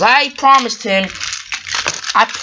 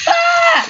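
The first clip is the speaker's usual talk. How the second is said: sudden very high, loud scream; a toy engine's high panicked scream; scream of alarm; 6 dB louder than usual. Acted. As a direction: shriek as the crash comes. Trevor about to be hit.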